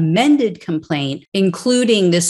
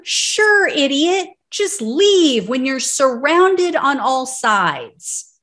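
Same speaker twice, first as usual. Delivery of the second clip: sarcastic scorn; 6 semitones higher, slower than usual. Real, not acted. Host mocking the dispatcher's advice to leave, scornful sarcasm. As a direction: pour scorn on a dumb idea.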